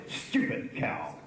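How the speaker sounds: low, drawn-out grumpy insult; bad-tempered mock shout. Former minister to woman driver, bad-tempered mock shout. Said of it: "Tizard bad-temperedly shouted".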